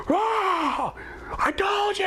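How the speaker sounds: high triumphant scream. Retold or imitated. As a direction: scream it high in her face. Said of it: "screaming in her face".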